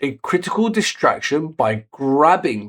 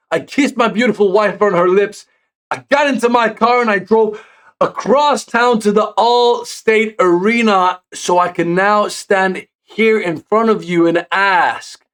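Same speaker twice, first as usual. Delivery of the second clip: hyped promo; 7 semitones higher, 3 dB louder than usual. Retold or imitated. Punk's victory promo, retold.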